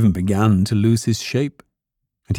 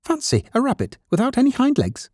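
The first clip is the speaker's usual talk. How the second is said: very high-pitched rabbit voice; mocking wild rabbit. A narrator's reading. Wild rabbit mocking legless toy rabbit, high scornful voice.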